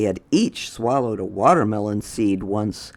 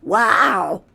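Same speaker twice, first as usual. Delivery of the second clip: very high, louder character voice; Wilson's voice; 11 semitones higher, 5 dB louder than usual. Acted. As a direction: squeak it out high and bright. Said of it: "said Wilson".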